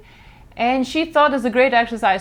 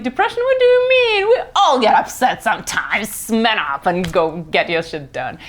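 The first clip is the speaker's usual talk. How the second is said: dismissive mock Russians. People back in Russia brushing off depression, dismissive. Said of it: "People are like".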